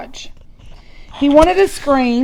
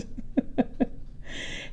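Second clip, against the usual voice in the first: breathy laugh; 2 semitones higher, 15 dB quieter than usual. Real, not acted. Reader amused by her own story.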